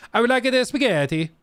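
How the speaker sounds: high, hammed-up Italian accent; mock Italian accent. Customer ordering at an Italian restaurant, exaggerated Italian accent. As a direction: put on a twisted, overdone Italian accent. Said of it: "weird, twisted version of an accent".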